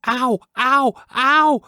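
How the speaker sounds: very high, loud yelps of pain; pained cries. Acted. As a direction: yelp as the trout bites. Trout seizes the frog.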